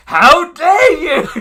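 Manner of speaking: high, loud, slow How dare you; hammed-up indignant shriek with a laugh; a mock scandalised cry, laughing; mock outrage. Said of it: "Ikutani's like".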